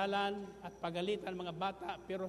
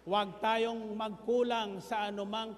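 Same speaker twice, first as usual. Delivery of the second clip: loud, rising sermon delivery; full-force preaching; 3 semitones higher, 3 dB louder than usual. Acted. Pastor exhorting the congregation, loud preaching. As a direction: drive the point home to the congregation.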